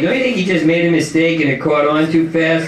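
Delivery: ordinary talk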